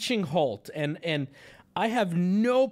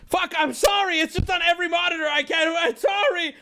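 loud, high, rushed panicked apology; frantic apology; 12 semitones higher, 6 dB louder than usual. Real, not acted. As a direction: shout excuses in a flustered panic. Frantic apology, video on every monitor.

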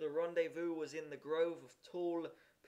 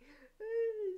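put-on high-pitched child's sob; whimpering little wail; squeaky crying sound; acted sob; 3 dB louder than usual. A narrator's reading. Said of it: "sobbed Jimmy".

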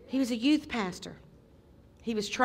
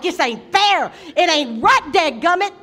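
loud, higher railing at God; angry protest; 6 semitones higher, 12 dB louder than usual. Retold or imitated. Man in his lowest place shouts at God, loud railing protest. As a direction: rail against the unfairness. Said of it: "That low of a place where you're just like".

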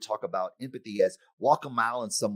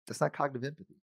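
ordinary talk, nothing marked

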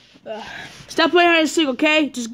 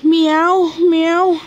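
high, loud pretend cat meow; 3 semitones higher, 4 dB louder than usual. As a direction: play a cat, meowing out high. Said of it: "I'm a meow cat".

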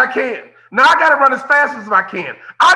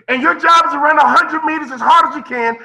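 ordinary talk, nothing marked

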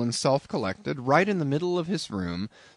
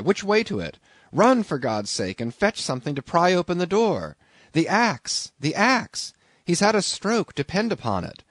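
urgent alarm; 6 semitones higher than usual. A narrator's reading.

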